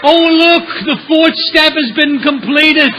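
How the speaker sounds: high, loud, slow ceremonial announcement; hammed-up pope-style announcement; mock proclamation. Mocking his friend with a grand declaration.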